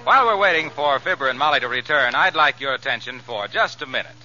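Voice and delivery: normal talking voice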